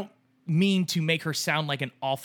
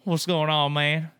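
put-on buddy's southern accent, a bit louder; 4 dB louder, slower than usual. Buddy answering a troubled friend's call, southern accent. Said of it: "in his southern accent, he just goes".

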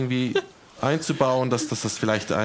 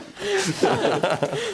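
high laugh. Real, not acted. Laughing at joke.

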